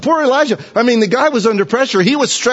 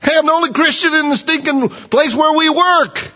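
lone-believer whine; 8 semitones higher than usual. Retold or imitated.

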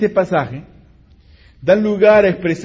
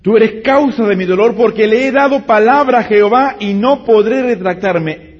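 anguished blame; 5 semitones higher, 8 dB louder than usual. Acted. Father blames daughter, loud.

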